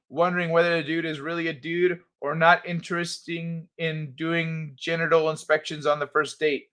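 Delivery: normal talking voice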